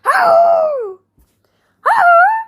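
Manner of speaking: high, loud roaring monster shout; monster yell. Acted. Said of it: "jumped up and down and yelled".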